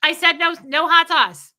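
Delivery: loud, high, impatient order; mock rude customer yelling; shouting customer. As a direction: shout the toppings at the worker. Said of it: "People shout at them all day".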